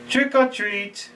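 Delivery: high, drawn-out trick-or-treat call. Acted. Joe at the door for trick-or-treat, high drawn-out call. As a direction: call it out high, stretching each word. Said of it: "yells out".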